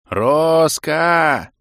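loud, high desperate yell; yelling at full lungs. A narrator's reading. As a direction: shout the name with all you have. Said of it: "завопил я, что есть мочи".

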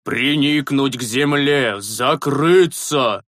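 slow commanding shout; shouting orders. A narrator's reading. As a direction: bark out the orders to get down. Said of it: "продолжал кричать старый священник".

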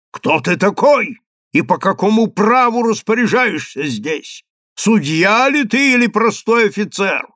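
high, heated accusation; indignant challenge. A narrator's reading. Chief rabbi questions the officer's authority, indignant challenge.

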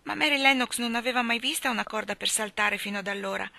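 ordinary delivery

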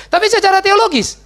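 high, loud stress on teologis; sharp turn to the theological view; emphatic contrast. Real, not acted.

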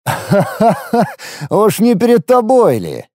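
slow, high gloating laugh. A narrator's reading. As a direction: laugh with malicious glee. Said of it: "злорадно рассмеялся я".

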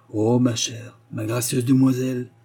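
ordinary delivery, nothing marked

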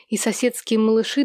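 plain reading voice

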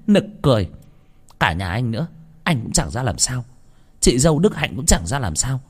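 mock the whole family with contempt; aunt sneering at her in-laws; scornful aunt.

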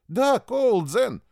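loud, high, slow eager cry; wholehearted. A narrator's reading. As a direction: agree with your whole heart, loudly. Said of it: "от всего сердца согласился".

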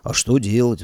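ordinary delivery, nothing marked

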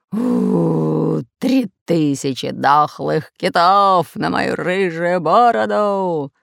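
slow, loud pirate howl. Acted. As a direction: howl the curse, drawn out. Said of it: "выл пират Бульбуль".

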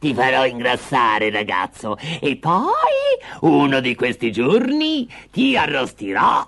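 slow, savouring witch threat; menacing witch. Acted. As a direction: relish the threat, stretching the final words. Witch threatens caged Hansel. (strega minacciosa che pregusta il pasto)

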